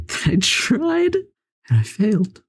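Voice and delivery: high, squeaky mock sob; mock crying. Player lamenting his score out of five thousand, mock crying.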